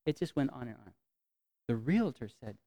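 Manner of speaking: normal talking voice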